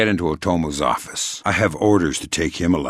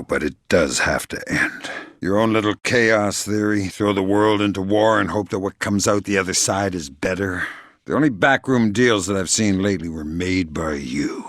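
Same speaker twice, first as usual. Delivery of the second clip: ordinary talk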